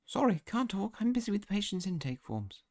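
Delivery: high-pitched receptionist voice; busy receptionist. Acted. Game receptionist turning students away over intake forms, high harried voice. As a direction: brush them off in a high voice.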